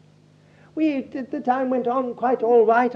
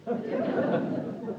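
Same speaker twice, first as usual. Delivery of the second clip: short airy laugh; breathy laugh; 10 dB quieter than usual. Real, not acted. Preacher laughing at his own irreverent quip, airy laugh.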